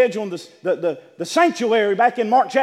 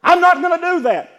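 firm refusal; 8 semitones higher, 11 dB louder than usual. Acted. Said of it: "You say".

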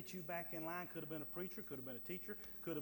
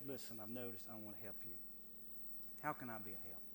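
soft concern; 7 semitones lower, 6 dB quieter than usual. Acted. Modelled gentle approach.

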